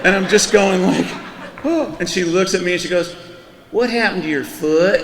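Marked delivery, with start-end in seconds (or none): 1.6-1.9 a much higher, startled oh; surprised oh. Retold or imitated. Noticing his hair standing straight up, a startled oh. 3.7-5.0 higher, imitating the clerk's Florida accent, puzzled. Clerk spotting the swollen foot, puzzled Florida accent. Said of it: "'cause the accent plays into the whole thing".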